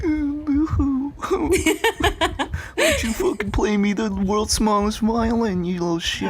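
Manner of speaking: high, sarcastic fake-pity voice; mocking. Mocking the annoyed coworker, high and sneering. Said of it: "I'm like".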